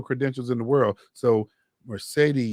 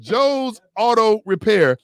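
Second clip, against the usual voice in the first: announcer plug; 6 semitones higher, 8 dB louder, slower than usual. Acted. Host hyping the sponsor's name.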